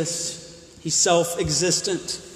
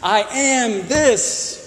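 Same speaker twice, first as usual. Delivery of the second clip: stretched out slowly, every word set apart; slow demo; 5 semitones higher, 5 dB louder, much slower than usual. Shown as a demonstration. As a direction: lay it out slowly as an example. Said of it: "It's a better sentence".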